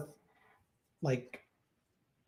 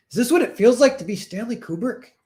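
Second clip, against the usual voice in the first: loud, higher-pitched comic despair; mock exasperation; 8 semitones higher, 6 dB louder than usual. Director wondering mid-session at endless retakes, hammed-up exasperation. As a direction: cry it out like an overwhelmed director.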